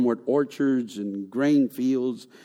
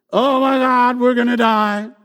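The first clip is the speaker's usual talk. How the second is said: high, louder dramatic whine; mock panic; 9 semitones higher, 6 dB louder than usual. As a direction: play the drama queen. Israelites seeing the desert.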